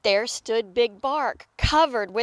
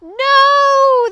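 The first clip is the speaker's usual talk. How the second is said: long, high crowd yell; big loud outcry; 13 semitones higher, 11 dB louder than usual. A narrator's reading. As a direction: holler a drawn-out refusal. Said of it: "the crowd yelled".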